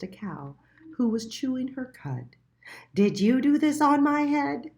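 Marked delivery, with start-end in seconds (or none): 2.9-4.7 high, loud squeaky question; a small indignant mole demanding answers; little mole voice. A narrator's reading. Little Mole asking the pigeon, high and indignant.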